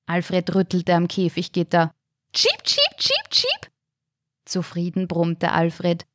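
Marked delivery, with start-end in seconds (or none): 2.3-3.7 very high bird chirps; budgie chirp. Acted. (hohes Wellensittich-Zwitschern)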